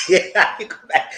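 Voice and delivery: can't hold the laugh in. Real, not acted. Reacting to Mia's comeback, laughing out loud.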